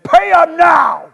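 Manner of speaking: slow, high, loud shout; angry demand. A narrator's reading. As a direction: bark it out, throttling him. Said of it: "He seized him by the throat and demanded".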